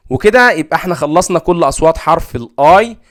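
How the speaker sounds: ordinary delivery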